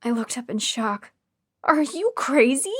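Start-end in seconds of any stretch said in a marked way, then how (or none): 1.6-2.7 high, loud and slow disbelief; shocked patient accusing captors; outraged. A narrator's reading. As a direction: throw the accusation at her.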